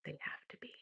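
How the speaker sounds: a sly whispered aside. Real, not acted. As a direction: drop to a whisper for the punchline.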